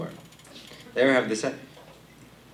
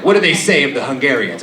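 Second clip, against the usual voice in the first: loud grandpa; 4 semitones higher, 9 dB louder than usual. Retold or imitated.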